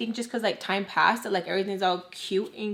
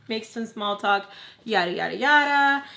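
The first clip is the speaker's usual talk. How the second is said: ordinary talk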